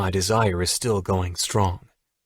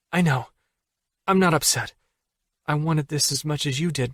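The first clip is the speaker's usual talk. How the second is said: higher female voice, insisting; Allie insisting; 5 semitones higher than usual. Acted.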